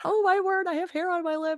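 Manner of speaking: high, startled gasp of dismay; woman discovering her mustache, shocked. Retold or imitated. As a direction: squeal it in horrified surprise. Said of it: "And I was like".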